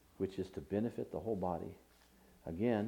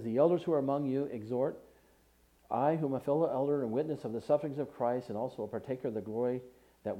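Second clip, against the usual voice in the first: raised, measured reading of Gospel verses; scripture reading; 3 semitones higher, 4 dB louder than usual. Acted. Preacher reading Jesus' words to the congregation, declaiming. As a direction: lift the scripture above the sermon, declaiming it. Preacher reads First Peter aloud.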